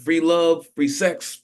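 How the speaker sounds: ordinary delivery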